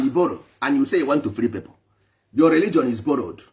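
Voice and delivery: ordinary delivery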